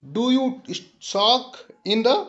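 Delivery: slow recitation of "It tickles my bones"; measured, unhurried poem reading; poem recital. Shown as a demonstration. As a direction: savour each line of the poem for the class. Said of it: "दूसरा स्ट्रंजा है कि".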